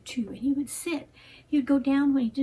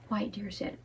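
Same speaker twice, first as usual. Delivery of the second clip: ordinary delivery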